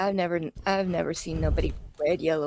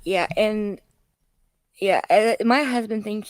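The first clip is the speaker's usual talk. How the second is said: ordinary talk, nothing marked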